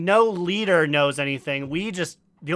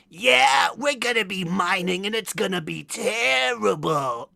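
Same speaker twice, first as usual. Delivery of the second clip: goblin shout; 3 semitones higher than usual. Retold or imitated. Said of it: "you hear, like".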